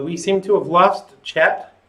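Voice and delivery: usual speaking voice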